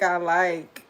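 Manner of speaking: usual speaking voice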